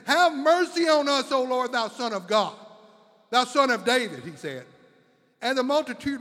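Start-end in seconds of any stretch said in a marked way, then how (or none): 0.1-4.2 loud desperate plea; crying out. Retold or imitated. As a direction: shout for mercy like blind men begging from the roadside. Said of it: "they were crying out".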